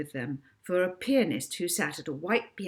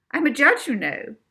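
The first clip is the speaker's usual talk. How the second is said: haughty declaration; 8 semitones higher, 8 dB louder than usual. Acted. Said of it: "declared Missus Toadley".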